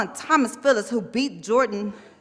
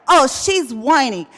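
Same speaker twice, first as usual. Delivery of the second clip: loud, drawn-out mocking quote; indignant quote; 3 semitones higher, 7 dB louder, slower than usual. Resident quoting officials' remark about her, indignant. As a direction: throw their words back, louder and slower.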